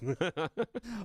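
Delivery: a high burst of laughter. Real, not acted. Telling the church story, bursts out in high laughter. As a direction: cracks up at the memory. Can't hold back laughter.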